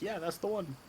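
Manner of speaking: high, soft pretend confirmation; Oleg. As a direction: pitch it up, lightly play-acting an identification. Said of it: "Perhaps have Oleg be like".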